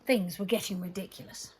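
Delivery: ordinary delivery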